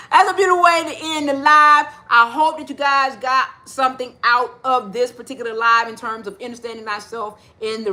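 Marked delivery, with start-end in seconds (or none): 0.0-1.9 laughing. Real, not acted. Laughs ending the song.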